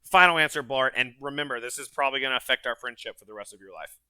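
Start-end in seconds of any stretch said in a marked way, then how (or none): 0.0-0.9 loud, insistent. Real, not acted. Pushing to move on.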